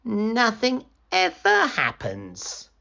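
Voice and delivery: slow, high, drawn-out moan.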